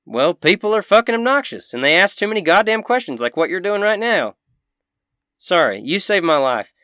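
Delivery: high, loud country crankiness; cranky Southern shopkeeper. Acted. Shopkeeper fed up with questions, cranky and high. Game master voices shopkeeper.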